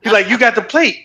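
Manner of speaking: high, eager stranger gushing; excited fan voice. Retold or imitated. Stranger at a gas station gushing at a biker, high and excited.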